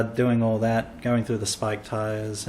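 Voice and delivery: ordinary delivery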